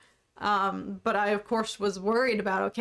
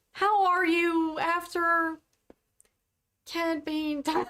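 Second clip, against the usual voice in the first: mock sympathy; 7 semitones higher, slower than usual. Mock-sympathetic chat address.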